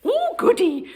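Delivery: put-on high, cheerful old lady; delighted granny. A narrator's reading. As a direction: say it like a happy grandma. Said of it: "said Granny".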